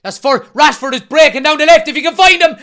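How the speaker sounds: high, loud urging of the attack; excited shouting. Real, not acted. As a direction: yell at the screen to pass. United breaking on the counter.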